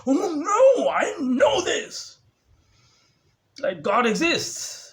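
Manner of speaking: high, loud whiny mock voice, slow; mocking mom. Speaker mocks mom insisting she knows. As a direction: ham up mom's whining defence.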